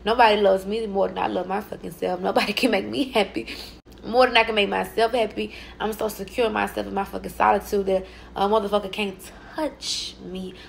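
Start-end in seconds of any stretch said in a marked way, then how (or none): none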